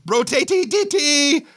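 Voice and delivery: squeaky-high silly chant on one note; high nonsense cry. A narrator's reading. Narrator trading silly noises with Meg, high nonsense cry. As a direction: hold it way up high, playfully. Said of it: "And I go".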